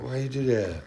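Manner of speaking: lightly voiced complaining pet; voicing the pet. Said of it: "He's like".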